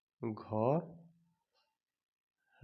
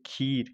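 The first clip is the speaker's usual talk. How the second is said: खीर with a lengthened ee; long vowel; much slower than usual. Shown as a demonstration. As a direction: hold the ee in खीर. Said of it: "उच्चारण long होता है".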